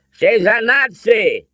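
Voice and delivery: high, loud, drawn-out accusation; heated countering. A narrator's reading. Father warns son about the woman, countering loudly. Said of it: "Henry countered".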